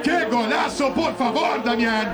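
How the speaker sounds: shouting the scorer's name; excited celebration. Acted. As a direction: hype the goalscorer.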